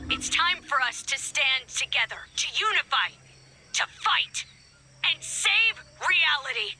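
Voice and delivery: rallying speech. Acted. Rallying everyone over the loudspeakers to stand together, stirring and resolute. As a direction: build phrase by phrase into a rallying cry.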